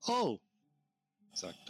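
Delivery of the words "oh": sharp high gasp of shock; startled cry. Acted. Player reacts in character.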